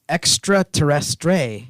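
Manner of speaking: extraterrestre said slowly with a French pronunciation; French word. Shown as a demonstration. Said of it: "that's how you say it in French".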